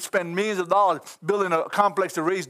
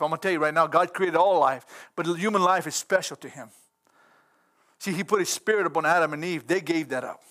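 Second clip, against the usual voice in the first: ordinary talk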